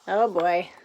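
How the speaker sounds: plain reading voice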